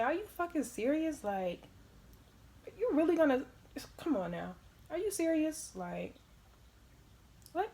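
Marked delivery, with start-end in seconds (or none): 0.0-5.7 high, loud mock-outraged rant; imagined outburst. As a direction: blast him with how ridiculous this is. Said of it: "I wanted to say".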